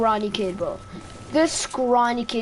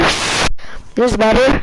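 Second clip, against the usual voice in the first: drawn out loud, slow test call; slow loud mic check; 6 dB louder, much slower than usual. Shown as a demonstration. Streamer testing the moved mic, slow and loud. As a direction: stretch it out loudly into the mic. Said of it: "Put my mic closer to my face".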